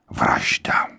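breathless whispered word; whispered confession. A narrator's reading. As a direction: whisper it as if afraid to say it. General confesses murder, whispered.

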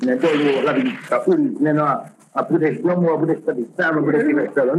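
usual speaking voice

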